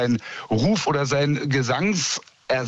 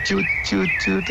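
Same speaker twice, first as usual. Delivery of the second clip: high, short fluted notes; birdlike piping; 5 semitones higher than usual. Retold or imitated. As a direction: chirp it like a blackbird. Birdlike goodbye after hearing the blackbird.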